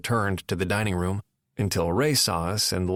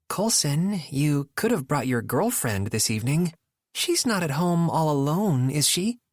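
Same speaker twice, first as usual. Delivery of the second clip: pointed needling; 6 semitones higher than usual. A narrator's reading. Nora voiced in story.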